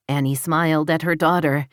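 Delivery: usual speaking voice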